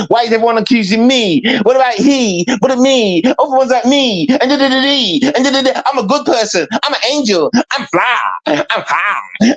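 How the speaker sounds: high-pitched mimicry of a woman playing victim; mock self-pitying whiner. Man mimicking Kiki in her "me, me" mode, mock whining. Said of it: "When you went into that mode".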